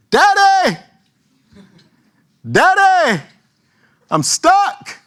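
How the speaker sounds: put-on high, loud little boy's cry; child calling for help. Son calling his dad from the playground, high and whiny. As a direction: call out like a stuck kid.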